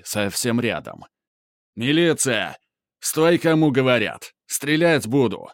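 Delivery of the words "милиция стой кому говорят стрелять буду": police shout. Acted. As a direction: shout orders while running. Мент кричит в погоне. (крик преследующего мента)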